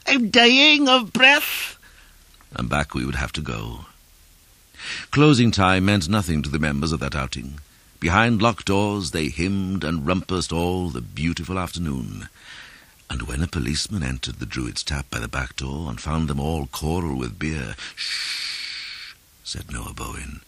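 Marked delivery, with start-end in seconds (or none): 0.1-1.8 very high, slow strangled bleat; piercing, stretched-out falsetto plea; billy-goat cry. A narrator's reading. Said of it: "cough like a billy goat and cry". 18.0-19.1 soft, drawn-out shush; hushing. A narrator's reading. Noah Bowen hushes the policeman.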